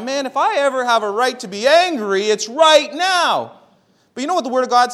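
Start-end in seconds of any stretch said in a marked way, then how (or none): 0.0-3.5 mock anger. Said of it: "And I thought".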